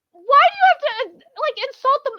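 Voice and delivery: high, loud indignant rant; angry. Real, not acted. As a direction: demand an answer, outraged. Ranting at localizers.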